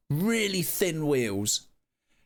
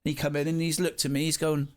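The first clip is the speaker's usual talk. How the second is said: ordinary delivery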